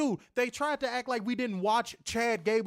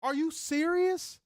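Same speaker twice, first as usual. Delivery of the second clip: disbelief; slower than usual. Retold or imitated. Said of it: "I'm like".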